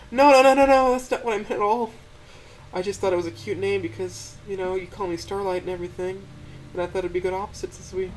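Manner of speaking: very high, loud, rushed denial; taking back a hurtful name in a panic; high flustered apology; Stella, flustered and apologetic. A narrator's reading. Stella's voice in the story.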